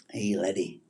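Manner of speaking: half-hearted attempt at a Scottish accent; failed Scottish accent. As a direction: try out a Scot's greeting, badly. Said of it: "I used to be able to do a Scottish accent".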